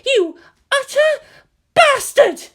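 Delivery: high, loud, sobbing scream of rage; tearful fury. Acted. Misato screaming at Gendo through tears, loud and high. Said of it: "she snarled".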